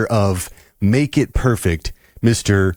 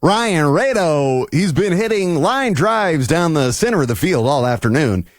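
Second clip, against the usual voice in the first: baseball announcer; 10 semitones higher than usual. Pretend. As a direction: announce the batter to the whole ballpark, stretching the name. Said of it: "say it in the baseball announcer voice".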